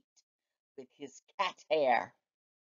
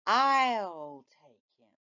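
lightly voiced mother, slow; a hint of a calm mom; slower than usual. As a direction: settle it like a steady mom.

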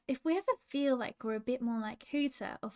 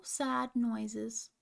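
plain reading voice

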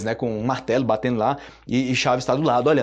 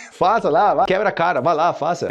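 louder, higher taunting dare; mock dare; 5 semitones higher, 6 dB louder than usual. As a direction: egg the other on in an argument. Acted quarrel, daring the other. (desafiando alto numa briga encenada)

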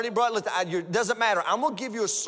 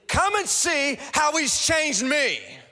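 much higher and louder, slow, building to shouts; preaching at full force; 9 semitones higher, 6 dB louder, slower than usual. Acted. Preacher modelling the testimony for the congregation, shouting. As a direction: preach it out with everything, rising to a shout. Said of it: "Your sermon should be".

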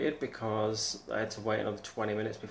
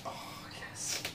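breathy hushed thrill; whispering before the first bite; excited whisper; 7 dB quieter than usual. Real, not acted. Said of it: "This is so exciting".

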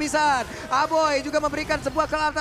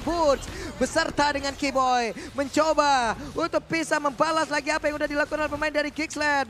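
ordinary talk

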